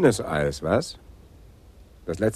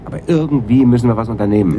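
impatient urging; 4 semitones higher, 7 dB louder, faster than usual. Acted. Urging colleague to act.

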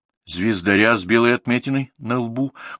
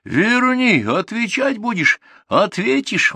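very high-pitched calling threat; high, drawn-out angry holler; shouted threat; 14 semitones higher, slower than usual. A narrator's reading. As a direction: yell a warning after him. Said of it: "закричал шофёр".

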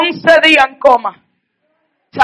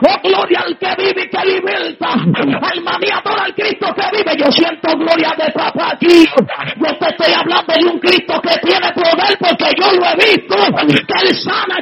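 higher, rising preacher's cry, shouting praise; fiery preaching; 4 semitones higher, 4 dB quieter than usual. Real, not acted. Preacher testifying to the congregation, full-force preaching. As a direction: preach it out with building fervor.

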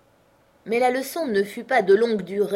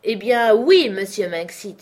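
louder, drawn-out yes; uncle conceding; 3 semitones higher, 8 dB louder than usual. A narrator's reading. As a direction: give in with a sigh of consent. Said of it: "fit mon oncle". (l'oncle qui cède, plus fort)

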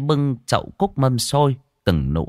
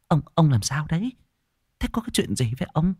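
higher-pitched, hurried questions; anxious urgent questioning; 6 semitones higher, faster than usual. Acted. Wife asks her shaken husband what happened, anxious and hurried. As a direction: press him anxiously for an answer. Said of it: "mà hỏi dồn".

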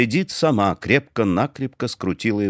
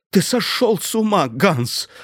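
frantic; 10 semitones higher than usual. A narrator's reading. Wife struggling for the gun.

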